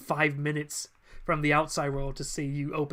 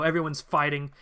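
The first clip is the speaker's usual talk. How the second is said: usual speaking voice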